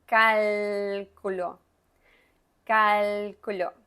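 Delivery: cálculo slow with the L tongue up at the roof; teacher demo of cálculo; clear l. Shown as a demonstration. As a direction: say cálculo slowly, placing both l's. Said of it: "Mire la L arriba de la boca".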